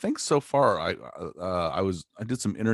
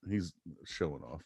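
usual speaking voice

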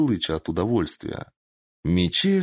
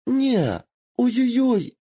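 put-on a much higher fox girl yelping "Ой-ой-ой"; high pained squeal; 3 dB louder, slower than usual. A narrator's reading. Kitsune pinned to the floor, yelping in pain. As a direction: yelp in pain through giggles.

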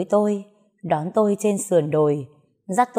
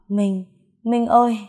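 delirious calling out; 4 semitones higher than usual. A narrator's reading. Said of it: "em không ngừng gọi tên Minh".

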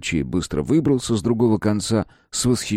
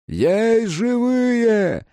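long drawn-out high shout over rubble; rescuer's call; 13 semitones higher, much slower than usual. A narrator's reading. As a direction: call out across the ruins. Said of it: "раздалось над завалами".

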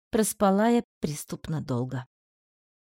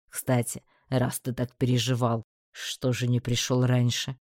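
calm low male voice; 8 semitones lower than usual. Acted. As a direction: explain it coolly.

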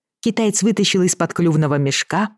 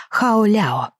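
Chinese pronunciation; 5 semitones higher than usual. Acted. Chinese word of approval.

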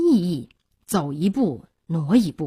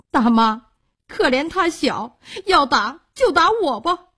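put-on boy begging, voice pitched up; tearful pleading; 7 semitones higher, 3 dB louder than usual. A narrator's reading. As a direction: plead high and urgently for a little sister. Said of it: "哥哥流着泪跪下讨饶". (含泪高声讨饶)

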